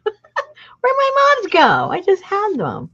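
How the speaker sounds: high, loud and puzzled; surprised. Real, not acted. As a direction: exclaim in disbelief. Lost her moths.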